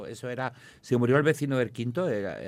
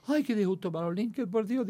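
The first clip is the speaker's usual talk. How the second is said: much higher, wailing mock lament; imitating crying mother; 10 semitones higher than usual. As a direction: ham up a weepy mother. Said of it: "se sienta llorando".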